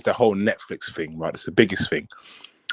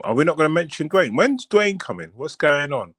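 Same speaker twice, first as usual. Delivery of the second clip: higher, pleading fan questions; impatient fans; 5 semitones higher than usual. Retold or imitated. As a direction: whine like fans wanting their star. Impatient fans asking for Dwayne.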